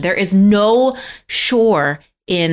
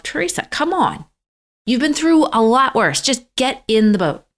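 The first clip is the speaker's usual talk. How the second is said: high-pitched retold self pep talk; urging herself on; 6 semitones higher than usual. Scared swimmer talking herself into jumping, high insistent urging. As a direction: coax yourself past the fear, pitched up. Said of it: "I'm thinking to myself".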